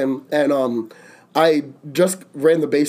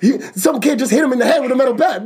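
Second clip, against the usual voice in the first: fast, high panicky report; retold panicked call; 7 semitones higher, faster than usual. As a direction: hurry through it like the cousin on the phone. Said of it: "so he goes".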